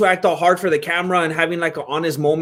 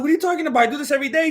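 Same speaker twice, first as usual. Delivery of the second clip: confused retort; 10 semitones higher, much faster than usual. Retold or imitated. He retorts to manager.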